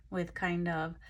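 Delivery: ordinary delivery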